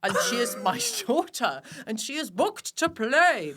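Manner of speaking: slow, loud, mock-formal father; manly dad voice. Dad arguing his daughter into the gig, slow and booming. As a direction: puff up like a proud dad vouching at the door. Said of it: "He was like".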